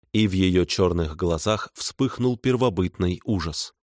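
usual speaking voice

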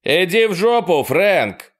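high, loud shout on the run; shouting while running. A narrator's reading. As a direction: yell it back over the shoulder. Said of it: "крикнул Майк на бегу".

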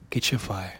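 ordinary delivery

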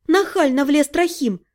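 plain reading voice

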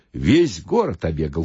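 usual speaking voice

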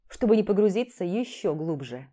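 plain reading voice

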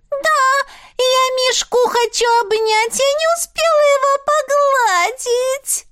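очень высоко, голос дрожит; вот-вот расплачется; девочка на грани слёз. Acted. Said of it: "Голос девочки дрогнул".

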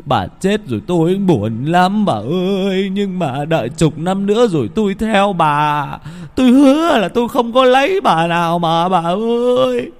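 rising into high, loud frightened pleading; trembling fear. Acted. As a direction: beg the ghost wife in terror. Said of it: "Ông Hai run rẩy". (giọng run rẩy sợ hãi, cao dần)

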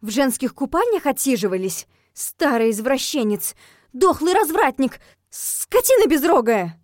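building to loud, high insults; indignant scolding. Acted. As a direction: hurl each insult harder. Hurling insults at the ghost.

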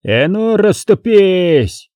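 high, drawn-out commanding cry; slow, stretched call over the fight; thunderous shout. A narrator's reading. Commander clearing the fighters aside, thunderous cry. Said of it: "громовым голосом вскрикнул".

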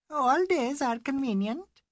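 Mrs Bhattacharya voiced high and slow; high slow Indian lady. A narrator's reading. Mrs Bhattacharya to the English ladies, high and slow.

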